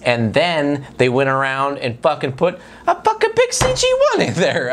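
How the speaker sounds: ordinary talk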